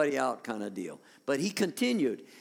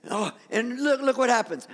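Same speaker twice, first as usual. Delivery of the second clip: ordinary talk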